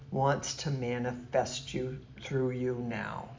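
normal talking voice

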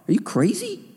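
high, mocking disbelief; incredulous scoff. Mocking own fear of speaking.

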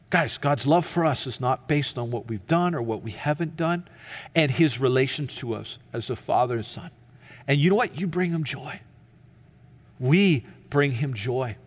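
ordinary talk, nothing marked

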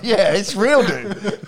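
laughing through the words, high. Real, not acted. Laughing while confirming story.

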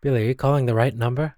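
ordinary delivery

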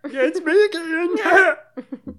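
high, slow put-on voice; slow squeaky mock character voice. Mimicking a movie character.

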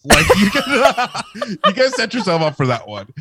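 high-pitched, laughing through the words; can barely get it out for laughing. Real, not acted. Laughs while teasing friends.

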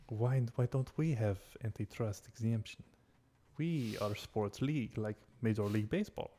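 light mock Russian accent; hint of a Russian accent. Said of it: "I said that in my Russian voice".

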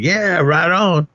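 high, drawn-out retold cheer; mock agreement. Mimicking guys' reaction to the line.

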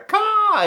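high, piercing mock caw; one high bird-call yelp; imitated bird cry. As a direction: squawk it out like a bird. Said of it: "burn noises in the ring".

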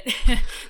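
loud unvoiced laugh breaking out; burst of laughter. Real, not acted. Laughing at her own uncertainty to her partner.

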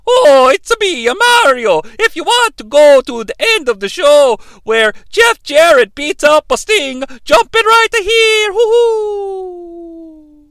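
high, loud, slow cartoon plumber voice; singsong game-hero call, ending in a long high whoop; Mario. Mario inviting listeners to jump ahead, high hammed-up cartoon voice. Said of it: "Mario, what can you tell us".

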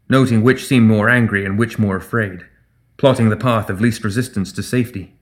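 usual speaking voice

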